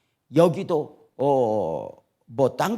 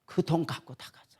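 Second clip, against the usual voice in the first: breathless whisper; 8 dB quieter than usual. Real, not acted. Preacher tells how the swindler ran off with the money, whispered. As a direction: whisper it in disbelief.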